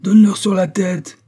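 high, loud command; urging on a fight at full voice; mob shout. A narrator's reading. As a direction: yell it over the uproar. Mob shouting during a riot.

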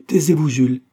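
loud, high, commanding rebuke; imperious. A narrator's reading. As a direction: silence the child with authority. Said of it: "s'écria la mère d'un air impérieux".

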